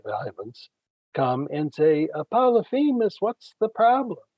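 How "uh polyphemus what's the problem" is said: higher, louder worried call; concerned Cyclopes calling. Retold or imitated. Other giants calling out to the wounded Polyphemus, concerned. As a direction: call up to him, alarmed.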